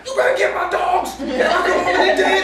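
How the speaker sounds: half-laughing, order her to save the dogs; mad yelling. Retold or imitated. On the phone demanding the neighbor rescue his puppies, mock angry yell. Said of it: "And so I'm getting mad".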